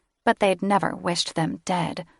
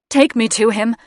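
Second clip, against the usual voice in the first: desperate plea; 4 semitones higher, 7 dB louder than usual. Acted. Grieving mother pleading.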